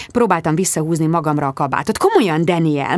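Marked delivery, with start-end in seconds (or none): none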